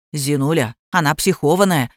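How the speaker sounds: plain reading voice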